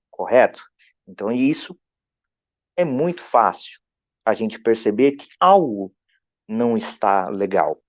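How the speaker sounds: usual speaking voice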